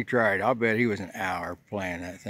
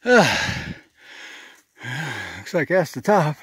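out of breath; 4 semitones higher, 4 dB louder than usual. Real, not acted. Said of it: "The old man's getting tired".

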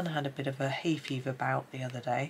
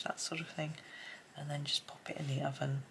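whispering; 7 dB quieter than usual. Real, not acted. Quiet aside while colouring.

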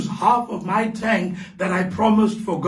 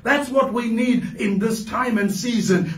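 preaching at full force; 3 semitones higher than usual. Acted. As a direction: lift the congregation with a fervent proclamation.

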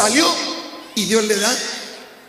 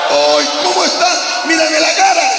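loud, high, drawn-out fussing; hammed-up concern from a fussing church member; mock pity; 5 semitones higher, 4 dB louder than usual. Mocks people pitying his illness. (lástima fingida y exagerada)

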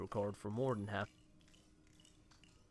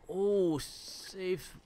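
excited; 8 semitones higher, 6 dB louder than usual. Real, not acted. Relief at finding safe room.

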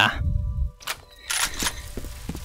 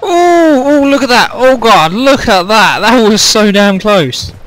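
excited; 3 semitones higher, 5 dB louder than usual. Real, not acted. Near miss in game.

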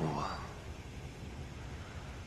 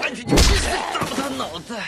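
fierce battle cry; 12 semitones higher, 4 dB louder, faster than usual. Acted. Zombie attack.

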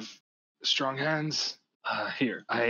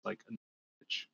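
usual speaking voice

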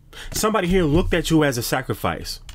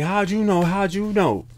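higher startled question; mock surprise; 5 semitones higher than usual. Enemies caught out, asking in mock surprise. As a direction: caught off guard, a touch higher. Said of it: "I'm getting like".

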